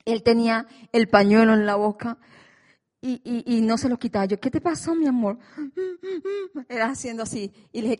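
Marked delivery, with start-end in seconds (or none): 4.4-5.4 higher, tearful worried question; tearful plea. Retold or imitated. Mother asking her injured son, tearful and higher. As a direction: ask through tears what happened.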